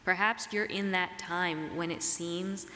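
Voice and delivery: ordinary talk, nothing marked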